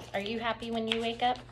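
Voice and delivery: ordinary delivery, nothing marked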